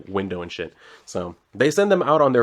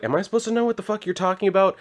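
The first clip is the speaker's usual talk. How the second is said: much higher and fast, a hammed-up incredulous snap; mock exasperated retort; 10 semitones higher, faster than usual. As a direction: toss it off fast and high like it's absurd. Retold game character's rude retort.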